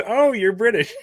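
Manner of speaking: high-pitched mock exclamation, breaking into laughter; imitating Curator. Podcast host retelling Curator's remark to co-host, high mock surprise. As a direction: squeal it like a sudden discovery. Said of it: "and Curator goes".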